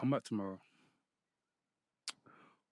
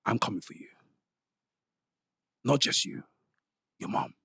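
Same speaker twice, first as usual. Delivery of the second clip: menacing whisper; 5 dB quieter than usual. Acted. Menacing landlord promo threat.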